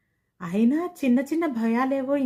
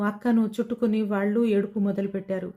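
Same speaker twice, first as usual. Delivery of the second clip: plain reading voice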